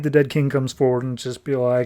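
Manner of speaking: usual speaking voice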